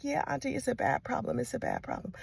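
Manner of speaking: soft, hesitant night-time admission; a hushed nephew at three in the morning; hushed confession. Retold or imitated. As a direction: quietly break the bad news.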